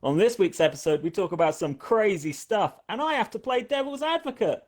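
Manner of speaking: hyped YouTuber intro voice; high, bright clickbait host read. Pretend. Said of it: "I always do that YouTuber voice".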